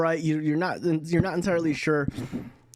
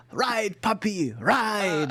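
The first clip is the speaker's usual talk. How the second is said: higher-pitched kobold cheer; gleeful kobold rider; 5 semitones higher, slower than usual. Acted. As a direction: urge your mount on like a little kobold. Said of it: "climbing up your scaly back and going".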